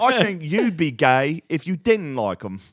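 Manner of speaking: slow, high British bloke parody with laughter in it; mock British accent. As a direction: argue it slowly in a put-on British accent. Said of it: "just British people talking like".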